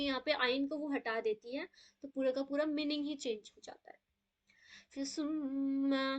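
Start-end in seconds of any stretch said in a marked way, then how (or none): 5.0-6.2 सुम्मा slowly, with the doubled m held and nasalised; nasal ghunna. Shown as a demonstration. Teacher demonstrating ghunna on सुम्मा for the class. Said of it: "यहाँ पे गुन्ना करेंगे".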